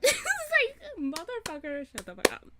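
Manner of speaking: cackling, fed up; laughing exasperation. Real, not acted. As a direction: laugh through the rant. Venting at the show, laughing.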